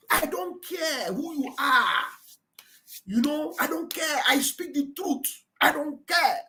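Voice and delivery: high, slow, drawn-out defiance; imitated defiant declaration. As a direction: declare it high and slowly, without raising volume. Re-voicing the leader's defiant quote.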